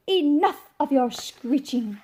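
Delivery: shouted. Acted. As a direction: bellow it, fed up with the fiddle. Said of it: "as he shouted".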